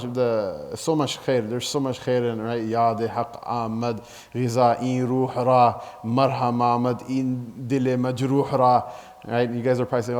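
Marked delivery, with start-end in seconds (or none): 2.6-8.9 slow Persian verse in desi Urdu accent. Shown as a demonstration. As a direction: recite the couplet slowly, Urdu accent. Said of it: "he's reading with the Urdu accent".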